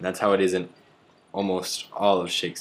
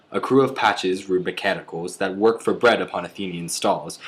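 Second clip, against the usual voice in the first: lilting iambic recitation; Shakespeare verse reading; 4 dB louder than usual. Acted. Reading Shakespeare as an example of iambic pentameter, rolling verse recital. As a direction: read it as metered verse, quick and rolling. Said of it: "I am going to read".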